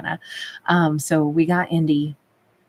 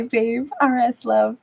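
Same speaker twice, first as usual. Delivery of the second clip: ordinary talk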